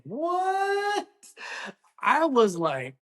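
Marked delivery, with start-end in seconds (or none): 0.0-1.1 very high, drawn-out what; high mock-scandalized what; shocked Southern lady. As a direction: play a scandalized older lady clutching pearls. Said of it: "Miss Mary over here say".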